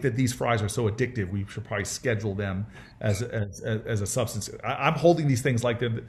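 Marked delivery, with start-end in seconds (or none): none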